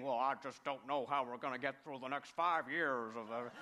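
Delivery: lightly done Texas pastor impression; a hint of a big Texas preacher; Texas accent. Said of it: "big barrel of a man with this Texas accent".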